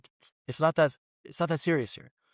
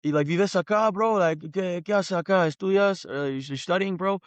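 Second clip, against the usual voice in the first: friendly local guy; 5 semitones higher than usual. Retold or imitated. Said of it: "he was like".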